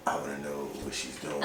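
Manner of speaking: whispered ghost voice. Pretend. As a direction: breathe it like a creeping ghost. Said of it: "That was the ghost voice".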